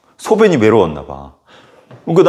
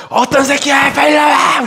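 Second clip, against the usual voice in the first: high, loud raging shout; furious yell; 3 dB louder, slower than usual. Retold or imitated. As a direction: demand they come out, enraged. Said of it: "이러는데".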